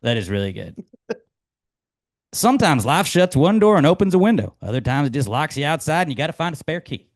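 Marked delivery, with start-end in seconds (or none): 2.3-7.1 higher, louder folksy quip; Ted Lasso quote. Acted. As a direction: deliver like a pep talk.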